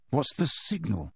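Harry muttering under the cloak; muttered. A narrator's reading. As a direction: ask under your breath. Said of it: "muttered Harry".

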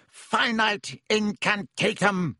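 high, slow, ringing incantation; shouted spell. A narrator's reading. Snape taking charge of the duel, shouted spell. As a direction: call out the spell, stretching each word. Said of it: "he shouted".

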